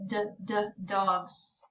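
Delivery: soft "d, d, dogs" sounded out; sounding out. Shown as a demonstration. Teacher quietly sounding out "dogs" for a child. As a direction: isolate the d before "dogs". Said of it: "at the beginning or end of d".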